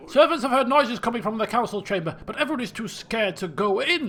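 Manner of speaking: anxious servant reporting trouble; alarmed servant. Acted. Servant telling the king of noises in the council chamber, alarmed. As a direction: report it urgently, pitch bouncing.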